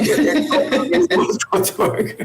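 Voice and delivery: laughing through the words. Real, not acted. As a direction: repeat it through helpless laughter. Teased, laughs while repeating own remark.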